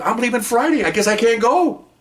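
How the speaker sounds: high-pitched mock complaint; retold dismay. Officer tells office his trip is off, imitated high dismay. Boss's man upset about cancelled trip.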